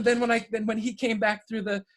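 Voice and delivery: ordinary delivery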